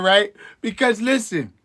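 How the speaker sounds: usual speaking voice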